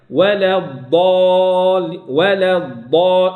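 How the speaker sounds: ولا with a thin lam, slow, then the dad; light lam slowly. Shown as a demonstration. Teacher shows the light lam before dad, slow recitation. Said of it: "أرقق اللام".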